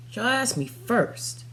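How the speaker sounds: usual speaking voice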